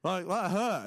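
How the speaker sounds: high, loud indignant complaint; indignant protest. Retold or imitated. Son protesting while mum laughs, high indignant. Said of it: "And I was like".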